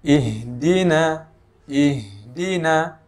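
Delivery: slow ihdina with breath flowing on h; slow ihdina, airy h; breathy h in ihdina. Shown as a demonstration. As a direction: let air flow through the h of ihdina. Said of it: "huruf H ini mengalirnya nafas".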